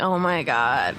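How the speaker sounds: low, sarcastic imitation of a friend. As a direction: put on his low, unimpressed sarcastic voice. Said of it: "his little sarcastic voice".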